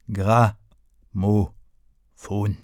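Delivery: drawn-out, very slow single word; teacher spelling out a new word, very slowly. A narrator's reading. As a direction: stretch it out like a teacher naming it. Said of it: "sagte er langsam".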